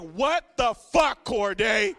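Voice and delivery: slow, loud outraged shout; family shouting. Retold or imitated. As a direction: yell it in disbelief at the news. Family reacting to vegan news.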